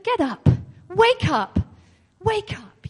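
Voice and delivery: Jesus calling loud to those outside; loud command. Retold or imitated. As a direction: summon the girl back to life. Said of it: "in a loud voice".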